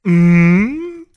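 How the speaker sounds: loud hammed-up hum; mock hum. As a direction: hum it like a moan. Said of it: "Hartley hummed into Sam's skin".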